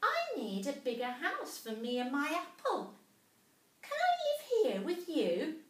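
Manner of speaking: a tiny mouse gasping in wonder; little mouse voice. A narrator's reading.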